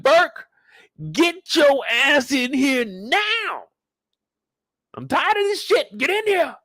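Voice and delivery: captain shouting across the office; angry boss yell. Retold or imitated. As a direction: yell for Burke like a furious boss. Angry captain yelling for Burke.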